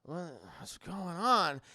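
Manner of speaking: slow, slurred waking-up voice; imitating a man coming round; groggy. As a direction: mumble it slowly, half drugged. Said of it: "he's super fucking groggy".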